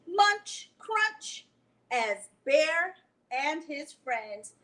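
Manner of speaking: slow, sing-song read-aloud voice; story reading to children. Acted. Librarian reading a picture book to children, sing-song. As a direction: draw the kids into the story, slowly.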